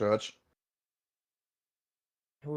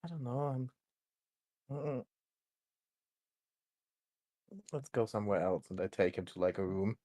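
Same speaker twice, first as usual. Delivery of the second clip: quiet, nervous, hesitant; hushed and uneasy; 9 dB quieter than usual. Acted.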